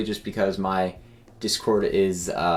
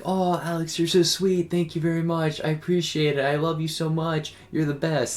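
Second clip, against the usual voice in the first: higher voice, gushing thanks; imitating grandma; 7 semitones higher than usual. Grandma thanking Alex for Mother's Day flowers, higher imitated voice. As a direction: play grandma gushing over her gift, pitched up. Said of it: "And she's like".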